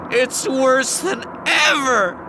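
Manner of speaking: high, loud, slowly wailed through sobs; sobbing. A narrator's reading. Husband bawling to his wife, high and slow. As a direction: wail it out, bawling. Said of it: "I started bawling".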